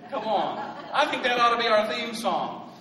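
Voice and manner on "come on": ordinary talk, nothing marked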